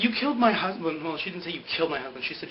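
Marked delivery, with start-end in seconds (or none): 0.0-1.0 higher-pitched retold accusation; mock accusation. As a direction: play the accusing widow, raised pitch. Acts out Sun accusing Ben.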